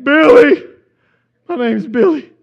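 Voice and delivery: loud, high blubbering; mock bawling. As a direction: cry out the name in hammed-up grief. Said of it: "just uncontrollably crying".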